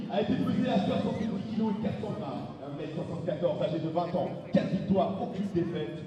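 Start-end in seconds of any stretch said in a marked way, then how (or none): none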